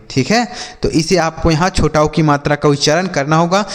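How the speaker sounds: ordinary talk, nothing marked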